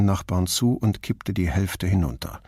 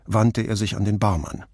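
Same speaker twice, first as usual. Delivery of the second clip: usual speaking voice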